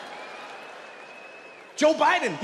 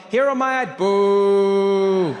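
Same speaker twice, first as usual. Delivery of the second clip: strange voice; 6 dB louder, much slower than usual. Pretend. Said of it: "with a strange voice".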